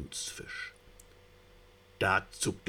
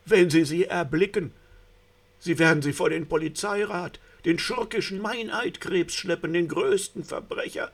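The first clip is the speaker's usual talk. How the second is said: higher and louder, breathless dread; rising panic; 10 semitones higher, 6 dB louder than usual. A narrator's reading. The narrator voicing the sea rose's panic as police crabs approach. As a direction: let the terror climb toward a shriek.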